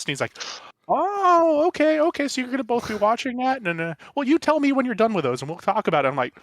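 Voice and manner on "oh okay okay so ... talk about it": high, loud imitation of a keen fan; excited father-in-law. Husband imitating wife's dad learning of podcast, excited. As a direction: start high and delighted, rattle on eagerly.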